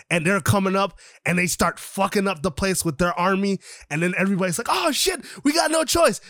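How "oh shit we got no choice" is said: panicked. Retold or imitated. Everybody panics as battle starts.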